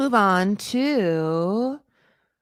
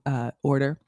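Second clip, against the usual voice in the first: ordinary talk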